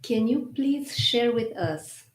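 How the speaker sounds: ordinary talk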